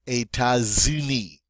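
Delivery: États-Unis with a Z linking États into Unis; liaison with Z. Shown as a demonstration. Teacher showing the second liaison. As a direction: join the S as Z into Unis. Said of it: "pronounce it now as a Z".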